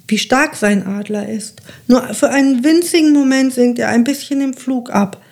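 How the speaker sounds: ordinary delivery, nothing marked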